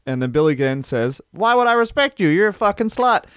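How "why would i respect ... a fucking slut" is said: higher sneering retort; hammed-up heel sneer; mock heel insult. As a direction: heel taunting a woman. Billy Gunn insulting Christy Hemme.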